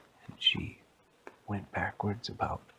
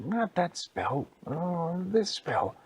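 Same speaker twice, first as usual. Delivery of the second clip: high, slow mock exasperation; exasperated spellcaster, high-pitched; frustrated witch; 8 semitones higher, slower than usual. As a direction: correct yourself in a high voice.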